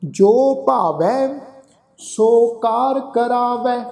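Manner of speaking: slow recitation with long held final syllables; measured level-toned sacred reading; scripture recitation. Acted. Teacher recites Gurbani to the congregation, slow scripture recitation.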